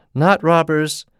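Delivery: frightened man denying robbers, terrified gasp. Acted. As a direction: blurt it out in fear. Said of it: "gasped the terrified man".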